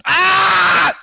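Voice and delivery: high sudden shriek; startled shriek. Real, not acted. Startled by a friend, high shriek. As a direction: yelp in surprise.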